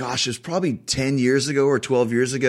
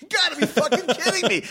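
pitched way up, incredulous and fed up; exasperated disbelief; 14 semitones higher than usual. Retold or imitated. Guest retelling being snubbed by Joan, incredulous high outrage. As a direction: throw the line up high in mock outrage. Retelling offer of autographed picture.

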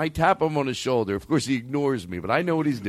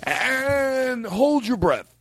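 high, stretched-out instruction; drawn-out cue; 10 semitones higher, much slower than usual. Pretend. Host cueing everyone to hold breath, high drawn-out command. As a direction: stretch the first word way out, high. Leads group in deep breath.

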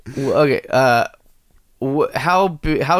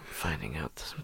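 whisper; 11 semitones lower, 19 dB quieter than usual. Real, not acted. Whispered aside about secrets.

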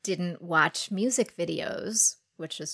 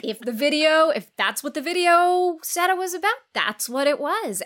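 higher, louder, absolute; mock certainty; 8 semitones higher, 5 dB louder than usual. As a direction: lay down the law. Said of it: "retelling friend's view".

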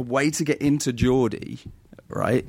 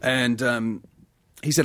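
ordinary talk, nothing marked

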